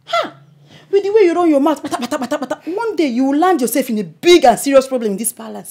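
loud, high rebuke; heated scolding. Acted. Chief scolding in palace quarrel.

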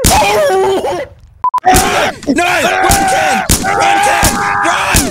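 high, loud desperate yell; panicked shout. Acted. Trainer warning his pet to flee, desperate yell. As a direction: yell for him to run.

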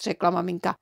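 ordinary delivery

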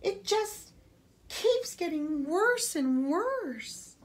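high, slow, despairing wail; a mother breaking down, about to sob; near tears. A narrator's reading. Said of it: "She began to sob".

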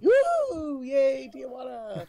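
high, drawn-out whoop; party cheer. Pretend. Friend cheering the idea of partying in Tijuana, playful high cheer.

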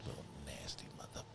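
hushed whisper. Real, not acted. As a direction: whisper while sneaking. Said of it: "I don't know why I'm whispering".

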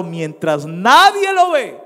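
high, loud cry of warning; climactic preaching shout. Real, not acted. Preacher warning the congregation about secret sin, climactic shout. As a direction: burst out loud, pitch leaping on the last words.

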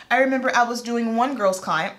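usual speaking voice

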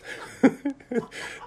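airy, high-pitched burst of laughter; high breathy laugh. Real, not acted. Preacher laughing at himself to the congregation. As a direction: let out a wheezy high giggle.

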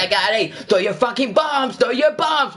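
high, urgent yelled orders; hammed-up battle shouting; mock frantic callouts. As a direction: yell panicked orders at your team. Re-enacting frantic game callouts.

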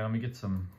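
ordinary talk, nothing marked

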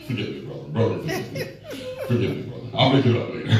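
soft, low, laughing plea; laughing apology. Real, not acted. Preacher laughing softly as he apologizes to a brother after a jab. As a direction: chuckle through a mock apology to the brother.

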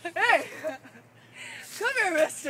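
loud shout across the park; yelling out. Real, not acted. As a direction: shout to get his attention. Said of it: "scream at the guy in the orange".

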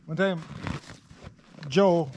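ordinary delivery

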